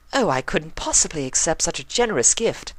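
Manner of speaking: high, politely protesting; graciously refuses the gift; Rarity's voice. A narrator's reading. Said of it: "Rarity argued".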